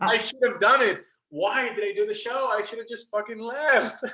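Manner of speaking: high, theatrical cry of regret; mock anguished wail. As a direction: wail it out in pretend despair. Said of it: "just screaming out Gethsemane".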